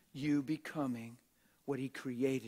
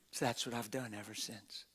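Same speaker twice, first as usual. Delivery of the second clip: ordinary talk, nothing marked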